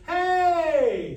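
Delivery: high, loud held call; smooth sustained call-out. Shown as a demonstration. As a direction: call it, don't shout it. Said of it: "it's a calling voice".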